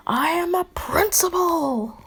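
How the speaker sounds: high, slow and emphatic; insistent little principal. Acted. Nezu correcting the boy, high and indignant. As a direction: insist on it, offended.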